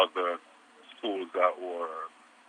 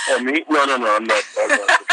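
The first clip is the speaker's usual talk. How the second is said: loud, high laughing denial; 8 semitones higher, 8 dB louder than usual. Real, not acted. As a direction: laugh it off, much higher.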